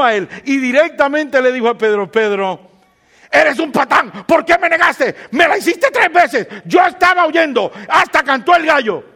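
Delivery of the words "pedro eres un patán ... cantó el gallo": higher, louder hammed-up telling-off; mock scolding. Pastor imagines Jesus scolding Peter, hammed-up mock anger. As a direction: play a Jesus who scolds, tongue in cheek.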